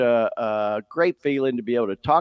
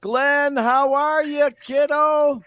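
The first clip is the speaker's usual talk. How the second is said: high, bright-pitched Christmas hello; happy greeting; 12 semitones higher, 4 dB louder than usual. Real, not acted. Dad greeting his son on Christmas Eve, high and happy.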